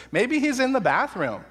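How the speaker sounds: high, laughing jab; laughing mockery, pitched high; mocking laugh. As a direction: crack up while delivering the punchline. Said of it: "my favorite line that he shouts out".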